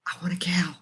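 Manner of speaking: soft conspiratorial whisper; sneaky whisper so her husband won't hear; whispered wish. Real, not acted. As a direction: whisper it like a secret plea. Husband says no, she whispers.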